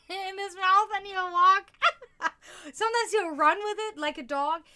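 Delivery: laughing through the words. Real, not acted. Owner laughing about her cat carrying his toy, giggling. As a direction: giggle while describing him.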